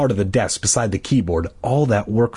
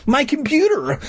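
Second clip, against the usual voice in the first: high excited cry. A narrator's reading. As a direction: burst out with excitement. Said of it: "I cried, excited".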